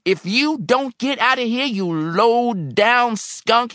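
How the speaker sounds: high, loud, drawn-out menace; wild threat. A narrator's reading. Old man threatening helper, wildly enraged. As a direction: scream the threat, stretching the insult. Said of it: "he said, looking wildly".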